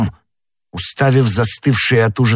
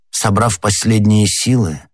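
ordinary delivery, nothing marked

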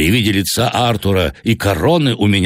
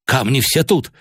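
objecting; 8 semitones higher than usual. A narrator's reading. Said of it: "возразил Артур".

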